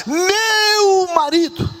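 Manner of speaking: very high, stretched-out "meu"; proud, mouth-filling cry. Acted. Preacher showing the wife's joy in saying it, proud cry. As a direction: fill the mouth with it, slowly and joyfully. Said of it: "ela enche a boca".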